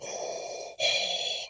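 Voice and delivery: heavy masked villain breathing; one long, noisy mechanical-style breath; Darth Vader. Acted. Villain finishes his decree, long ominous breath. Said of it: "President Trump is Darth Vader".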